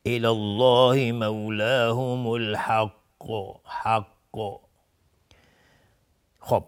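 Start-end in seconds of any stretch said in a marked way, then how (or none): 0.0-4.1 الحق ends on a bouncing, echoed ق; stopping with qalqala. Shown as a demonstration. Teacher showing how to stop, qalqala on الحق. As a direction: recite slowly and release the ق of الحق with a bounce.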